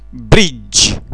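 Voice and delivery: "bridge" ending on a Romanian ge without the i; hard dj ending on "bridge"; English j sound. Shown as a demonstration. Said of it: "se pronunță g fără i". ("bridge" terminat în ge fără i)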